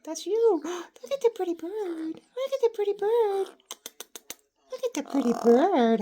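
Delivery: high, bright delighted coo; excited pet talk. Acted. Owner showing the parrot its reflection, high and gushing. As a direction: gush over the pretty bird.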